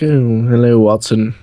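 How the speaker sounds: hammed-up British accent; Sherlock Holmes. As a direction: put on a caricature British detective. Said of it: "like Sherlock Holmes".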